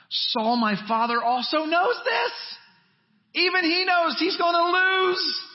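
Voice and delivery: high, loud, slow proclamation; climactic preaching. Acted. Preacher declaring even Saul knows, high and loud. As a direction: build it to a triumphant peak.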